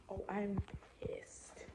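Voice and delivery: hushed so coworkers won't hear; whispered pissed. Shown as a demonstration.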